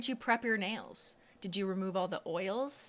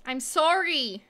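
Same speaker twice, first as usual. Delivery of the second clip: higher, louder drawn-out sorry; loud pleading sorry; 7 semitones higher, 7 dB louder, slower than usual. Real, not acted. To the stalled nail robot, begging forgiveness, loud and pleading. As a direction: plead loudly with the machine. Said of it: "Sorry, I'm getting heated".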